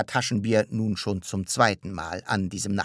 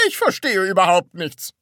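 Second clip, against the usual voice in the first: high, loud, baffled voice; baffled protest; 11 semitones higher, 9 dB louder than usual. A narrator's reading. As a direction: declare it in exasperated confusion. Baffled listener protests at the explanation. (völlig verständnislos)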